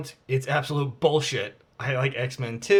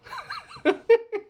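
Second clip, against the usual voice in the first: squeaky high cartoon giggle; a tiny high fish laugh; Nemo laugh. Showing the viewers an impression, squeaky high laugh. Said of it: "That's my Nemo impression".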